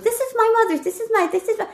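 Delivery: high-pitched imitation of a happy mom; delighted mother. Mother looking at old things, having fun. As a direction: play mom cooing over keepsakes, voice way up. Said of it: "So she was having fun".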